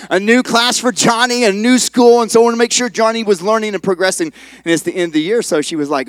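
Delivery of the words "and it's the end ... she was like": ordinary delivery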